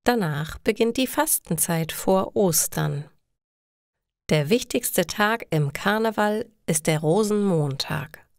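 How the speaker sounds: ordinary delivery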